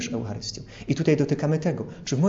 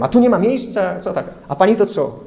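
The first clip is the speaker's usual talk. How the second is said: snappy, louder mock retort at a tram passenger; rude retort; 4 semitones higher, 6 dB louder than usual. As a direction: bite back like an irritated passenger.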